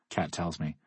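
plain reading voice

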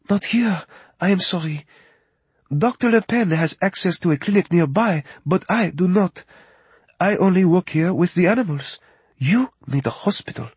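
high, light French woman's voice, apologetic; a young French vet's assistant, sorry she can't help. Acted. Narrator voices Justine.

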